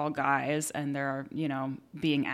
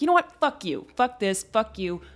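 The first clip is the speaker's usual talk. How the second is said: much higher and louder retold blowup; fed-up outburst; 5 semitones higher, 4 dB louder than usual. Speaker retelling losing it at hecklers, loud exasperated swearing. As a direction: let it all out, swearing at them. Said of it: "after a while, I was like".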